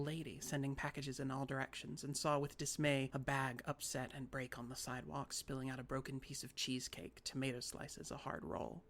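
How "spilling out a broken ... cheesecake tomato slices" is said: ordinary delivery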